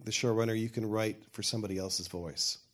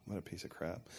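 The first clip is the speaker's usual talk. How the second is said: quiet, breathy mock dismay; muttered self-reproach; 3 semitones lower, 11 dB quieter than usual. Writer looking back on old pilots, muttered mock dismay. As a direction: say it low under your breath, imitating your own letdown. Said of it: "when I look back on them, I go".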